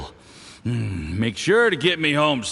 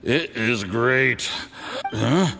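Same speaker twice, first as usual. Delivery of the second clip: ordinary talk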